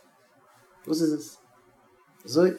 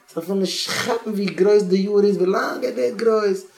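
emphatic; 7 semitones higher, 5 dB louder than usual. Real, not acted. Emphatic point about what children know.

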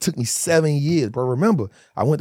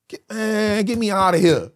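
higher-pitched exasperated "let me out"; retold desperate plea; 8 semitones higher than usual. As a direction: act out begging to escape. Retelling wanting out of prison.

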